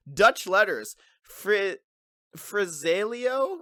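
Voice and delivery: usual speaking voice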